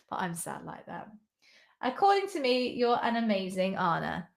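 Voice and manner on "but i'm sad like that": a soft whispered joke at yourself; whisper, self-mocking; whispered aside. Real, not acted. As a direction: breathe it as a sheepish aside.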